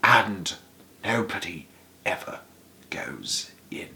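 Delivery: soft, slow, breathy secret; secret whisper. A narrator's reading. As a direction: whisper it close to the child's ear. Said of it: "lowered his voice to a soft, secret whisper".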